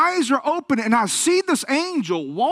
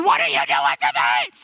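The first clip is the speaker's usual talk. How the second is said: strained mock yell of alarm; shaking, vibrating shout; 9 semitones lower than usual. Man overcome on the floor to preacher, imitated shaking shout. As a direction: imitate a man shouting as his body shakes. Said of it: "he just starts shaking and vibrating".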